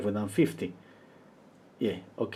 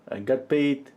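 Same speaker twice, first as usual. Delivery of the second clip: normal talking voice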